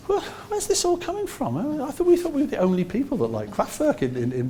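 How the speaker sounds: high, amazed and laughing; can't believe someone else does this; incredulous laugh. Retold or imitated. Band realising others like synths, laughing in disbelief.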